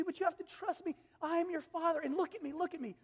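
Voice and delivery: put-on a loving father explaining to his child; God as father. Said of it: "and he says".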